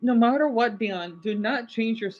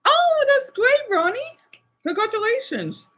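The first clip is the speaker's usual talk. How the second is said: bright high burst of joy at the news; excited congratulations. Real, not acted. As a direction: cheer him on, pitched way up and slowed. Reads happy news from chat.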